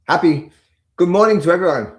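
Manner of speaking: loud laughing greeting. Real, not acted. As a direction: laugh through the words.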